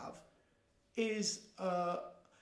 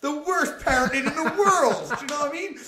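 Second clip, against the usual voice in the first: higher and louder, laughing through it; laughing disbelief; 6 semitones higher, 10 dB louder than usual. Real, not acted. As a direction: laugh out the words in real disbelief. Laughing at abusive stepdad memory.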